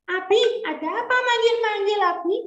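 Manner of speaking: put-on high, quick child's voice; a boy answering his father eagerly. Young Ismail answering his father's call in a high, quick child voice.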